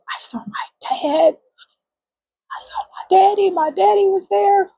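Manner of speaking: high, breaking whisper into sobs; choked up seeing her late father; tearful whisper. Real, not acted. Recalling her late father, whispering through tears.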